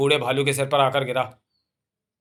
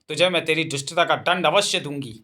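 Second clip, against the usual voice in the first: ordinary delivery, nothing marked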